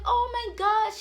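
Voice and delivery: high, loud delighted gasp; excited mom. Retold or imitated. Mother meeting son's girlfriend, high thrilled gush. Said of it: "the mom is like".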